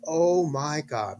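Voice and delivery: slow drawn-out oh my God; mock surprise. Dad playing shocked for his daughter, slow mock surprise. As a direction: fake alarm to catch her attention. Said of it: "every time I say".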